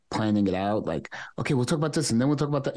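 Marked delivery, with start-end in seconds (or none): 1.4-2.8 rapid, higher excited chatter; fast talking. Retold or imitated. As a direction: rattle off the plan quickly. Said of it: "he talks fast".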